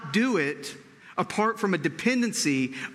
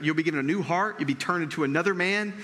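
ordinary delivery

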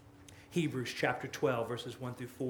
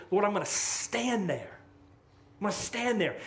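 higher, louder preaching build; full-force preaching; 6 semitones higher, 5 dB louder than usual. Acted. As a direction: lift the congregation, preach it hard.

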